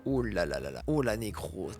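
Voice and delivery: very low, drawn-out oh là là; low dismay. Acted. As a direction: sink into a low groan.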